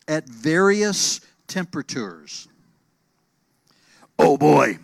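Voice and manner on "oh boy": booming dinosaur papa; big loud booming dad voice. Acted. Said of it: "in his loud booming voice".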